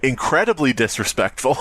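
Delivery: ordinary talk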